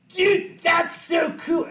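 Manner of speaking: high, loud "Dude, that's so cool"; excited gush. Retold or imitated. Fan meeting the musician he loves, excited high gush. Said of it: "Actually, no, that was him".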